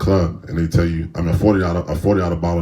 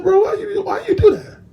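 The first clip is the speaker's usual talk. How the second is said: much higher, put-on voice calling himself out; scolding inner voice; 4 dB louder than usual. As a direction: play your own conscience asking why.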